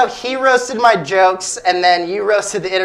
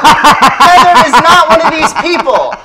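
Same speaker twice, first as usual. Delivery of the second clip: loud, higher comic reveal with a laugh; spring the punchline on them; big reveal; 8 semitones higher, 7 dB louder than usual. Real, not acted. Host revealing the answer to the audience, loud and laughing.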